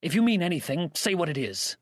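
out of patience. A narrator's reading. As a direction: demand it, patience used up. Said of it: "The man was at the limit of forbearance".

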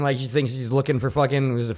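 normal talking voice

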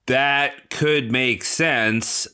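normal talking voice

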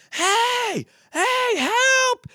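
high calling out to a passing boat, waving; hey, help, flag them down; mock call for help. Retelling calling to a passing boat, a high cry for help.

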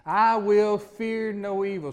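slow declaration, each word weighed; slow, deliberate. Shown as a demonstration. Preacher leading the congregation in a slow, firm declaration. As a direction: lead the church in saying it slowly. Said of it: "Say that again with me".

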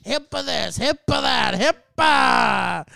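loud, high, scornful shouting that builds; imitated angry shout. ER patient mocking the nurse's rule, imitated rising shout.

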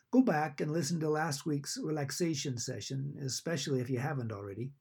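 ordinary talk, nothing marked

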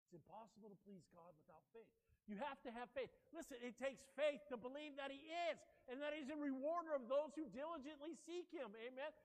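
normal talking voice

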